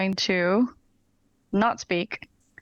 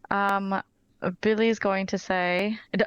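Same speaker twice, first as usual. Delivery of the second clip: ordinary talk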